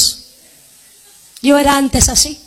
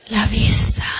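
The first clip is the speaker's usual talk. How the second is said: whisper; 3 semitones lower than usual. Acted. Blind man's plea whispered.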